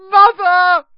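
piercingly high, loud cry for help; scream. A narrator's reading. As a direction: scream out for your mother. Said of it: "screamed the boy below".

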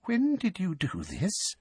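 hushed, high and slow with a slight tremble; slow, high, tremulous question; slightly trembling. A narrator's reading. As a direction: ask slowly and softly, voice barely controlled. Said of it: "her voice trembling slightly".

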